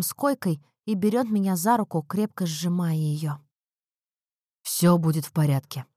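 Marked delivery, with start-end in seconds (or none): 4.6-5.9 slightly husky male-character voice; hoarse reassurance. A narrator's reading. Husband holding wife's hand in hospital, hoarse reassurance. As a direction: reassure her, voice a little hoarse. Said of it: "хрипло говорит он".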